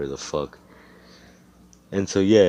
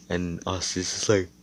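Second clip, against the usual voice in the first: ordinary delivery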